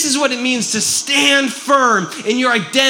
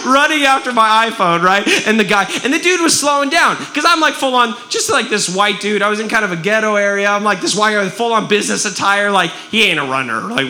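ordinary talk

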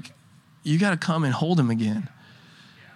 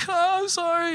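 put-on childlike apology; 13 semitones higher, 4 dB quieter than usual. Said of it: "And then I'm like".

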